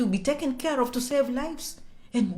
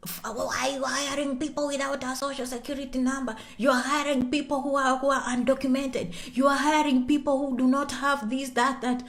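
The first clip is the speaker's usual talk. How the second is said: mock interrogation in a half-whispered voice; put-on official, hushed and reproachful; acted accusing questioner; 2 semitones higher, 3 dB quieter than usual. Acts out officials questioning employers.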